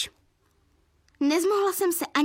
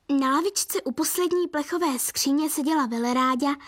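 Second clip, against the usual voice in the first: usual speaking voice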